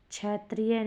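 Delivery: क्षत्रिय begun as "chai"; wrong pronunciation. Shown as a demonstration. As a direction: say क्षत्रिय as "chaitriye". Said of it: "नहीं".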